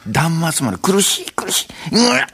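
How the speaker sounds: strained agonized groan rising to a cry; death cry. Shown as a demonstration. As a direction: act out a dying agony.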